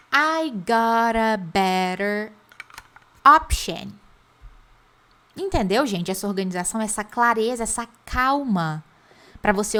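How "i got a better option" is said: chunk by chunk, pausing before the last word; I got a better, then a beat, then job; spoken slowly for students to repeat; slow drill. Shown as a demonstration. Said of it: "Então, vamos lá".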